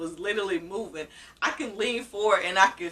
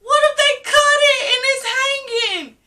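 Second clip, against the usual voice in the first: panicked yelling; 10 dB louder than usual. Retold or imitated. Groggy patient asks her aunt what got cut, panicked yelling. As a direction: re-enact a frantic patient crying out. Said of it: "I was like".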